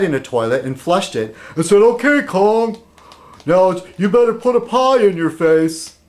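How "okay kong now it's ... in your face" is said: high, put-on voice of a rival vlogger; mock a challenger making demands; inaccurate mock voice. Imitating John demanding the pie, in a high mock voice. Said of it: "he doesn't sound like that".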